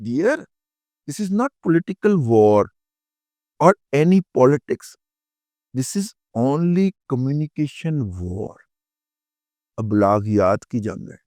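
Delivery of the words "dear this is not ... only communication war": very slow, word by word; slow deliberate English. Real, not acted. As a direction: spell it out slowly like a lesson. Lecturing slowly in English. (آہستہ آہستہ سمجھاتے ہوئے)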